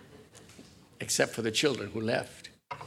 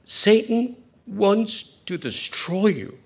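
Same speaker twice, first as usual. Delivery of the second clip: slow and louder, higher; solemn slow warning; 8 semitones higher, 7 dB louder, much slower than usual. Acted. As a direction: drag each word out as a grave warning. Jesus quoted, solemn.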